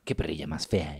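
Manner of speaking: low, soft murmur to a dog; mock baby-talk murmur. Friend imitates how host talks to his dog, soft low murmur. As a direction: croon it quietly like to a pet. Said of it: "como un susurro medio".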